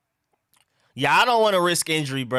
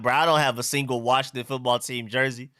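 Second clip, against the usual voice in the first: ordinary talk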